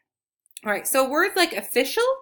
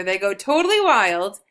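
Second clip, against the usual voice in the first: ordinary delivery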